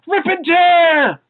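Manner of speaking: high, drawn-out hammed-up battle cry; mock war cry. Fan quoting the Doom comic, hammed-up war cry. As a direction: stretch it into a comic high yell.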